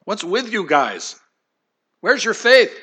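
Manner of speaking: a hammed-up New York–New Jersey scold; New York accent. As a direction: ask it like a Jersey guy who's fed up. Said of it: "as if Jesus was from New York and New Jersey".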